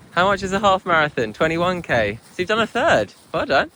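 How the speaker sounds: high, loud, bubbly praise; excited praise. Real, not acted. Mum praising daughter after seven K, excited and loud. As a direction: cheer her on after the run.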